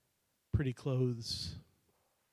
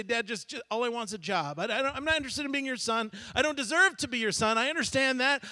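rehearsed plea to dad; 6 semitones higher than usual. Acted.

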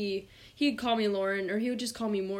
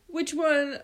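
raised-pitch mock parent question; 6 semitones higher, 3 dB louder than usual. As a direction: lightly imitate a concerned parent. Parent questioning a hurt child.

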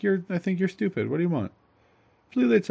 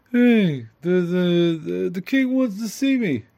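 lightly voiced sitcom husband, higher; Archie Bunker; 4 semitones higher, 3 dB louder, slower than usual. Husband comes home to his wife, mock Archie Bunker. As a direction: announce it like a sitcom dad. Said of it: "Archie Bunker coming home to his wife".

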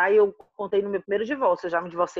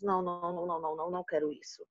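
muttered inner refusal; 4 semitones lower, 12 dB quieter, much faster than usual. Retold or imitated. Inner refusal of Sunday visits.

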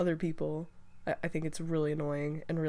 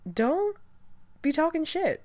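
scolding; 9 semitones higher than usual. Acted. Scolding kids who trash their moms.

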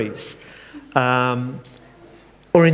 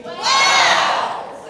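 very high delighted wow. Acted. Preacher cueing the congregation's wow response. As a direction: let it burst out in wonder. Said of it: "you're going to say".

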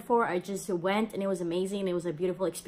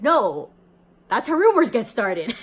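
much louder protest; loud mock denial; 3 semitones higher, 9 dB louder than usual. Girl loudly denies healing rumor, mock protest. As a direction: push the rumor away with a laugh.

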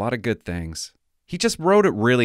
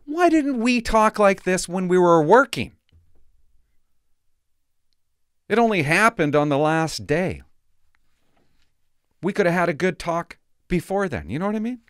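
exasperated rant; 8 semitones higher, 5 dB louder than usual. Real, not acted. Exasperated rant, raised pitch.

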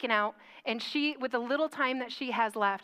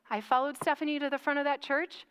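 usual speaking voice

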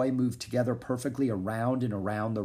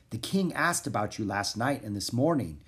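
plain reading voice

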